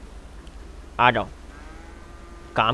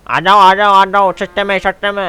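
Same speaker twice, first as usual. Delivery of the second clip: louder vendor call; calling out to buyers; 5 semitones higher, 5 dB louder than usual. Acted. Calling buyers at the cattle market, loud vendor shout. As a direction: hawk it like a market seller. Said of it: "अरे सेल लगा रही है क्या?".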